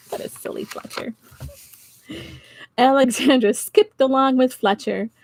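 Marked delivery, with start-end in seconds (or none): 0.1-1.1 fast soft whisper. Real, not acted. Whispered aside.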